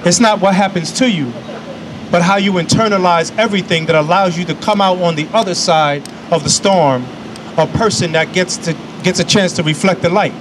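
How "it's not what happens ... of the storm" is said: normal talking voice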